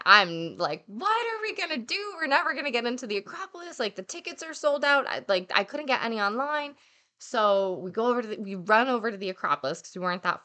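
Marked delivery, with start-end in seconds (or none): 0.9-5.1 high panicky wail, much higher; retold panic. Scene: retelling her meltdown to her friend, high panicky voice. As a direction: panic about missing the Acropolis. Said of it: "I'm losing it".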